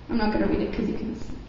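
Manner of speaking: fast, breathy throwaway aside; whispered aside. Real, not acted. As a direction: rush it under your breath. Quick aside during a presentation.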